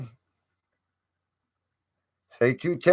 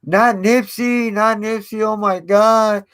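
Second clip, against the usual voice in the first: mock screaming; 6 semitones higher than usual. Vlogger mimics overacting interviewee at crime scene, mock scream. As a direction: overdo the wailing, but only as a mocking imitation. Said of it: "like, yelling and screaming".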